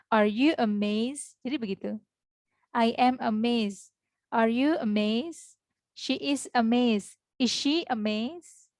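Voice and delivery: slow, clearly paced teacher examples; deliberate drill reading; slow model sentences. Shown as a demonstration. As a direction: read each example slowly for the class. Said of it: "teacher models questions".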